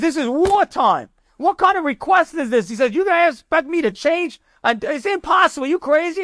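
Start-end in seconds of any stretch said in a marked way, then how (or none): 0.0-2.7 high-pitched, indignant outburst; retold angry yell, pitched well up; yelling general. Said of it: "he starts yelling". 2.9-6.2 high, incredulous rant; retold outburst of outrage, pitched well up; angry general. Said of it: "he's got so angry".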